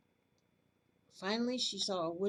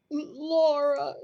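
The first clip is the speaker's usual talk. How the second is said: sobbed; 6 semitones higher, 11 dB louder than usual. Acted. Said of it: "she sobbed".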